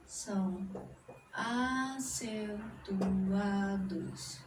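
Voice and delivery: slow, word-by-word dictation with long pauses. Shown as a demonstration. Teacher dictating the rule to students, slow. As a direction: dictate each word slowly so students can copy. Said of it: "dictating the rule slowly".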